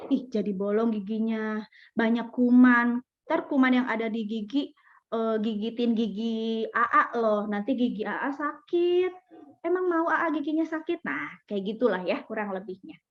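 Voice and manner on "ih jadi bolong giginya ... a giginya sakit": put-on soft tender parent voice explaining germs to a child; gentle storytelling to child. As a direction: coax a little one gently, like a bedtime warning. Parent telling child about germs.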